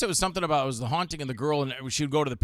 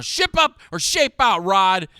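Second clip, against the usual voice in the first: much higher, louder tough-love order; mock stern yell; 5 semitones higher, 7 dB louder, slower than usual. [name] scolding scared Rod into the scene, mock yelling. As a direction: bark it like a drill sergeant.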